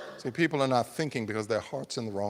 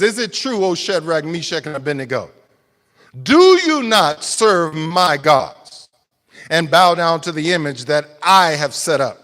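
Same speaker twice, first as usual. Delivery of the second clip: king's challenge; 4 semitones higher, 10 dB louder than usual. Retold or imitated. Preacher voicing Nebuchadnezzar confronting the three, loud accusing demand. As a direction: lean into the king's affront. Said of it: "And then he says".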